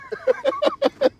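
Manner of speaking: short high shriek of laughter; high cackle. Real, not acted. Laughing at the teaching.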